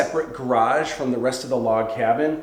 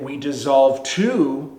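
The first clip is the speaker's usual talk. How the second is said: normal talking voice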